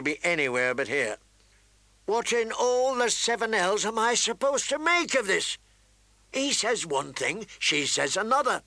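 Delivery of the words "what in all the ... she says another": high, exasperated outburst; fed-up royal complaint; exasperated king. A narrator's reading. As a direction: throw up the question in frustration.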